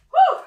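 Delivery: high exhaled whoosh of relief; breathless whew. Real, not acted. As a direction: blow it out after the sprint. Exhale after hard set.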